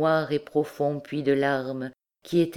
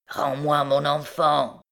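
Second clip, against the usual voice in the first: slow, relentless demand; obsessed mother, measured and unyielding; implacable recluse. A narrator's reading. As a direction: insist slowly, deaf to all pleas. Recluse repeats her implacable demand.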